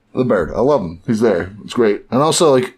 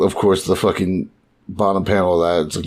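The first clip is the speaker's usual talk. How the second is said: usual speaking voice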